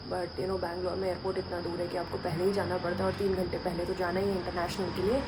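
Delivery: soft, low hushed vlogging; hushed speech. Real, not acted. Vlogger beside a sleeping household, very softly. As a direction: keep it down for someone asleep. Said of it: "अगर मैं बहुत धीरे-धीरे बोल रही हूँ".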